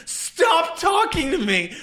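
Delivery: high, drawn-out exasperated cry; exasperated outburst. Retold or imitated. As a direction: stretch it out, pleading for it to end. Retold exasperated outburst at friend.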